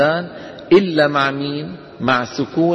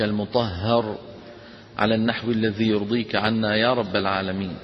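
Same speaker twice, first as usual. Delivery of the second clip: soft, low devotional supplication; opening prayer; 4 semitones lower, 5 dB quieter than usual. Real, not acted. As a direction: pray quietly before the lesson. Opening prayer before the lesson. (دعاء خاشع بصوت منخفض)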